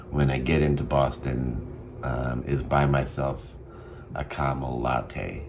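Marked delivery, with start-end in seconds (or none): none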